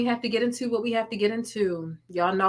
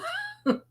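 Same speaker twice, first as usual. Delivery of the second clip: high teary squeal; 5 dB quieter than usual. Real, not acted. Cries out, laughing.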